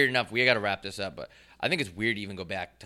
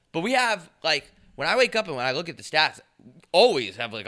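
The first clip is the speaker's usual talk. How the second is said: usual speaking voice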